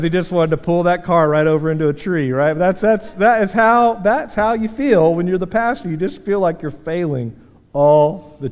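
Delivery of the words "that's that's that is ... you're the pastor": ordinary delivery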